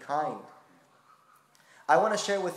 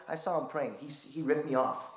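ordinary talk, nothing marked

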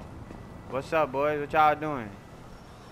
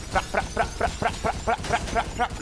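mock gunfire; 6 semitones higher than usual. Imitating gunfire.

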